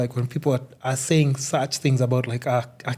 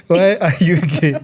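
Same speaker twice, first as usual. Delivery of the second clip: laughing, louder repeat of the clip; Ugandan clip quote; 4 semitones higher, 6 dB louder than usual. Retold or imitated. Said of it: "Even my friends are like".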